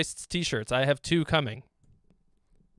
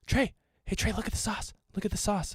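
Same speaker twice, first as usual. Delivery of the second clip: mock pleading viewer; 6 semitones higher, 5 dB quieter than usual. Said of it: "You're like".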